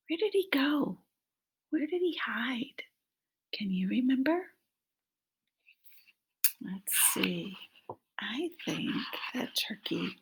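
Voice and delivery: hushed quiz whisper; secretive whisper. Acted. Reading to children, asking in a hushed whisper.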